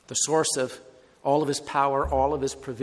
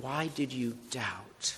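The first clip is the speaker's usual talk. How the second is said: soft repeated question; 5 semitones lower, 13 dB quieter, slower than usual. Shown as a demonstration. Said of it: "let's focus on Jesus' question".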